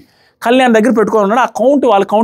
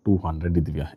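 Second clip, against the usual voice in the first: very low, barely voiced murmur; hushed request; 13 dB quieter than usual. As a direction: imitate asking discreetly under the breath. Retold discreet request for money. (గుసగుసగా అడిగినట్టు అనుకరణ)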